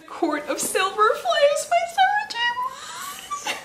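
high teary squeal of the book title; tearful squeal. Real, not acted. A YouTuber squealing a book title through happy tears. Unwrapping her most wanted book.

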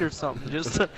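ordinary talk, nothing marked

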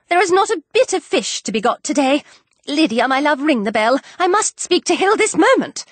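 high, excitable flutter; excited, fussing wife. Acted. Wife expecting Mister Bingley and ordering dinner, high and flustered. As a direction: rush from delight into fussing, pitched high.

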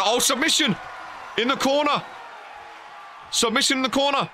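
excited call. Real, not acted. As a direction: yell it like the crowd just rose. Commentator reacts to submission hold.

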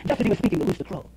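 ordinary delivery

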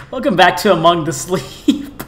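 laughing through the correction. Real, not acted. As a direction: giggle as you fix the name. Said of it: "I mean, welcome".

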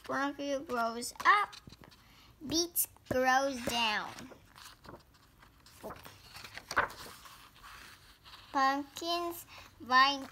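slow sing-song storybook read-aloud; picture book reading. Acted. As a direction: read each page like a picture book for little kids.

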